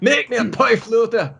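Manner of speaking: high, laughing Scottish demand; mock Scottish. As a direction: order it in a hammed-up Scottish voice. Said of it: "If you're part Scottish".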